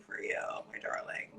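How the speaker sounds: soft whisper to the guest; whispered aside. Real, not acted. Host whispering an endearment to her guest mid-sentence. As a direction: drop to a whisper for "my darling".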